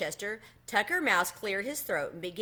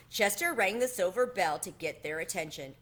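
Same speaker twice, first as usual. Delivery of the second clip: usual speaking voice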